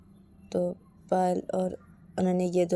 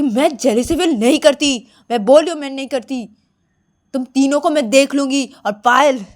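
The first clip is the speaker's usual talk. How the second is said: louder, higher angry insistence; acted indignant vow; defiant threat; 7 semitones higher, 10 dB louder than usual. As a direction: warn them with raised voice. Ananya sits, threatens the three friends.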